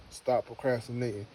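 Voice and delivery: normal talking voice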